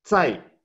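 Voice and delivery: Zai said high with a falling fourth tone. Shown as a demonstration. Teacher showing the fourth tone on zai, high and falling. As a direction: drop zai sharply from high. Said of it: "con cuarto tono".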